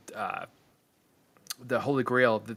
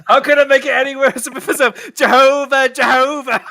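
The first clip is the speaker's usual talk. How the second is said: role quote; 13 semitones higher, 12 dB louder than usual. Retold or imitated. Acting the blasphemer scene.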